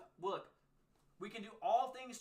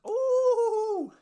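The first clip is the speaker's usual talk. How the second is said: a sudden squeal, much higher and louder; excited ooh; 11 dB louder than usual. Real, not acted. Streamer seeing chat's dream places, excited squeal. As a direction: yelp with delight at the list.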